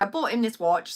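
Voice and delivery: usual speaking voice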